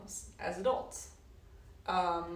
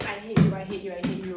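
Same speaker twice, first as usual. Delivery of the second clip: loud I hate you, first one loudest; venting yell; 10 dB louder than usual. Shown as a demonstration. Someone releasing anger by kicking the floor, loud venting yell. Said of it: "kicking the floor and saying".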